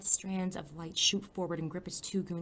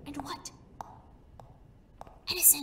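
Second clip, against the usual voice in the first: urgent whisper; 8 semitones higher, 5 dB quieter than usual. Acted. Hiding, calling mentor in whisper.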